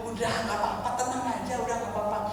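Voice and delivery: soft, unruffled reassurance; calm husband. Retold or imitated. Husband soothing his crying wife, calm and soft. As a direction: soothe quietly, imitating an unworried husband. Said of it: "Suami saya tenang".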